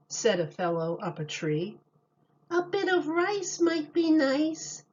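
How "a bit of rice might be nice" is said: put-on much higher baby voice. A narrator's reading. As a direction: say it like a little baby. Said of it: "said a baby sucking ice".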